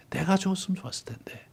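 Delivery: whispered repeat of 내가 죽었으면, soft and broken; whispered lament. Retold or imitated. David grieving his son, whispered anguish. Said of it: "차라리".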